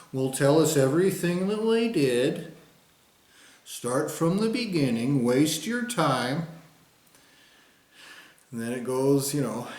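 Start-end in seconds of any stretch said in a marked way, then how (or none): 0.1-6.5 higher, louder, slow condescending official; mock bureaucrat. Mocking officials telling him to start over, slow and patronizing. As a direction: drag it out to waste their time.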